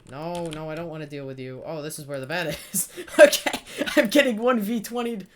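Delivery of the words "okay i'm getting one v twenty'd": loud, high gamer yell; excited shout. Real, not acted. Gamer hyped while playing, loud excited shout. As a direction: shout it out mid-fight.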